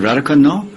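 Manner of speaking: "Radhakund, no?" rising on "no" into a question; questioning rise. Retold or imitated. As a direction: lift "no?" high so it sounds like a question. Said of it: "the intonation indicates that it's a question".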